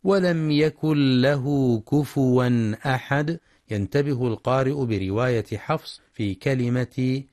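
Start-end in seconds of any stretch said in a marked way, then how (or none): 0.0-3.4 slow measured tajweed chant; Quran recitation. Shown as a demonstration. Teacher reciting verse to radio listeners, measured chant.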